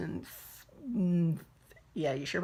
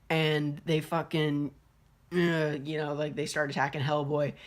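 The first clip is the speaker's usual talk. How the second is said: normal talking voice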